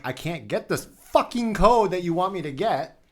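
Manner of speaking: fed-up retold complaint, pitched high; exasperated. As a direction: vent at a useless support bot. Retelling his exasperated reply to bot.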